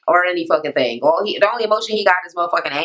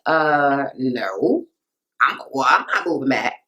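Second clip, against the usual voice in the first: imitated reluctant refusal; 7 semitones lower, slower than usual. Acts out boyfriend refusing.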